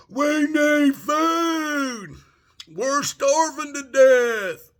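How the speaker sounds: high, drawn-out imitated shout from a window; mock desperate yell. Mocking Shanghai residents yelling from windows, high desperate shout. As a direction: wail it like a starving crowd. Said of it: "yelling out the windows".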